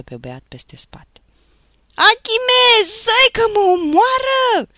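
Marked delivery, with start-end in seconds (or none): 1.9-4.7 far higher, much louder panicked scream; desperate howl. Acted. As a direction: scream to his brother for help. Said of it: "urlă Nicolae". (urlet disperat, mult mai sus și tare)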